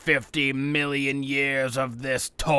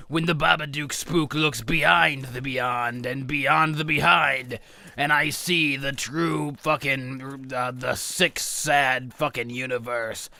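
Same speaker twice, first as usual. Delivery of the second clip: ordinary talk, nothing marked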